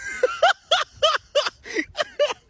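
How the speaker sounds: long, high peal of laughter; real laugh. Real, not acted. Storyteller laughing at their own intervention, in a high peal. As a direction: burst out laughing mid-story.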